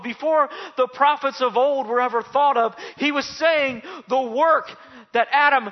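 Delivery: high, loud rising preacher's climax; preacher driving home the finished work; preaching at full force. Real, not acted. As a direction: build to a thundering proclamation. Sermon builds to full force.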